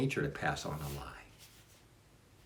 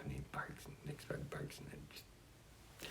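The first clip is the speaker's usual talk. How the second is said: fading whisper; 7 semitones higher, 19 dB quieter than usual. Real, not acted. Dogs barking, voice fading out.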